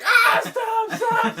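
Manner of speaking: high, loud laughing plea to stop; pained yelling stop. Retold or imitated. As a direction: yell stop between hits. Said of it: "he's like".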